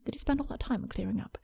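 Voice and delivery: unvoiced, hushed aside; whispering. Real, not acted. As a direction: whisper it to yourself.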